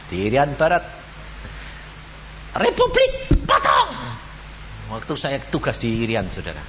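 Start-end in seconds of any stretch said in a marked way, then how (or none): 2.6-4.2 high yelled battle cry; distant rebels yelling orders; retold rebel shout. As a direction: bellow it like soldiers attacking a boat.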